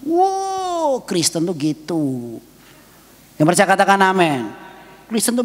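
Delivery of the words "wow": a high, drawn-out wow of wonder; amazed wow. Acted. Preacher marvels at his jailed friend's faith, amazed wow.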